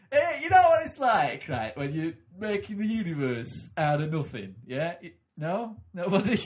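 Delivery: high, loud, slow comedian voice; milk the crowd like a club comic; God as stand-up. Acted. God as a stand-up tries out a creation joke, high and loud. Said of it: "he'd be going".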